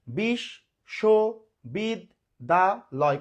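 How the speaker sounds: বিশ্ববিদ্যালয় slowly as "bish - sho - bid - da - loy"; five separate beats on বিশ্ববিদ্যালয়; syllable by syllable. Shown as a demonstration. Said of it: "পাঁচবারে উচ্চারণ করলাম". (বিশ্ববিদ্যালয় পাঁচ ভাগে, থেমে থেমে)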